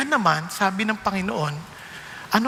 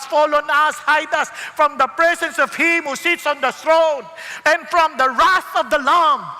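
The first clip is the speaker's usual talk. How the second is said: louder, higher pleading to the mountains; desperate cry; 5 semitones higher, 5 dB louder than usual. Acted. People begging the rocks to hide them, loud pleading cry. Terrified people voiced in scripture.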